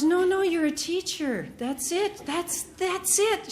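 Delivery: high, eager, drawn-out that's it; a friend thrilled by her idea; excited insistence. Retold or imitated. Meg insisting she is teacher.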